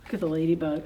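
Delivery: usual speaking voice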